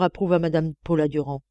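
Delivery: ordinary delivery, nothing marked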